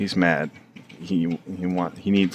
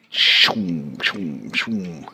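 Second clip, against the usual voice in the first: zooming car noise; much slower than usual. Pretend. Car zooming sound effect.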